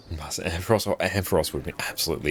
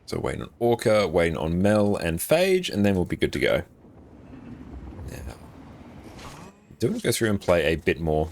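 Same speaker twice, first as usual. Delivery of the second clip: ordinary talk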